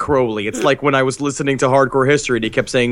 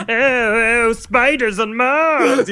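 high, slow theatrical wail; hammed-up high-pitched stage cry; mock costumed shouter; 9 semitones higher, much slower than usual. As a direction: wail it like a silly costumed showman. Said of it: "some asshole in a costume shouting".

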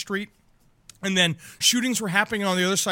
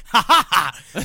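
laughing; 6 dB louder, slower than usual. Real, not acted. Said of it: "Maybe I am channeling".

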